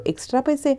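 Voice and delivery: incredulous echo of the demand; surprised. A narrator's reading. Man repeats the woman's demand for extra money, incredulous. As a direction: pitch jumps high on the money question.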